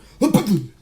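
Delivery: loud, high crash sound effect; imitated impact noise. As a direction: voice a body thudding onto metal. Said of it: "my whole torso just went".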